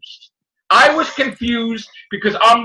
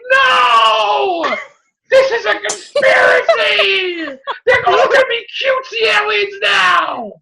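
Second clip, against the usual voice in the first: panicked outrage; 13 semitones higher, slower than usual. Retold or imitated. Kid yelling at a toy record, high and alarmed. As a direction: cry out that it's a conspiracy. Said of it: "And I'm like".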